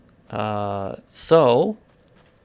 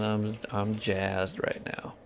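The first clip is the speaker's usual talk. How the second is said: deadpan jazzed; 2 semitones lower, 7 dB quieter, slower than usual. Real, not acted. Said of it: "This is my jazzed voice".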